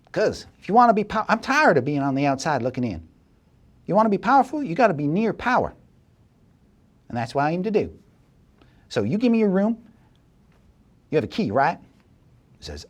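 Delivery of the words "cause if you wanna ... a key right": high, quick, eager voice; GM's schemer lays out her ambition, high and fast; ambitious schemer. Acted. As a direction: rush through the pitch for power. Same woman explains ambition.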